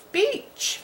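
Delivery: "Beach" squeaked high and soft with a rising tune; sounding out a word. Shown as a demonstration. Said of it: "C H says cha".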